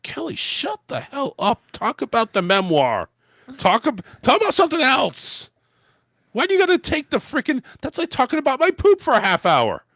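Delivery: high-pitched mock scolding; worked-up, high comic outrage; exasperated rant. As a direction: rant at an absent celebrity in exasperation. Mock scolding of the talk-show host.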